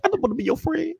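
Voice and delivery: tiny, very high cartoon voice; cartoon character. Threatening to join streams like this, cartoon character. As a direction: squeak it softly, way up high. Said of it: "start talking like this".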